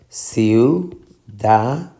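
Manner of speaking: ciudadanos in slow, separate syllables. Shown as a demonstration. Teacher dividing ciudadanos for students, syllable by syllable. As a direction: break it up: ciu, da, da, nos.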